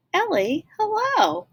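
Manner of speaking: high, loud sing-song hello; mock old-lady hello; old lady greeting. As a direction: call out sweetly like a granny.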